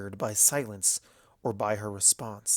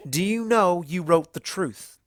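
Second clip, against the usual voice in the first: louder, higher voice; calling out; 6 semitones higher, 7 dB louder than usual. A narrator's reading. Applejack challenging Everfree, calling out. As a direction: call out the challenge. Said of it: "Applejack called out".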